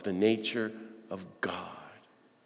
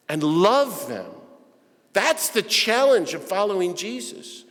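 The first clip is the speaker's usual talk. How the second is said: higher, louder preaching surge; preaching at full force; 4 semitones higher, 5 dB louder than usual. Acted. Preacher rouses the church about Gandhi, full-force preaching. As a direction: drive the point home to the congregation.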